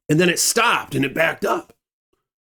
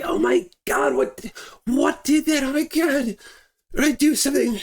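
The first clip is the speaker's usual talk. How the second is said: imitating a frightened high-pitched plea; terrified; 12 semitones higher than usual. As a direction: act out panic, voice jumping high. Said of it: "they're just terrified".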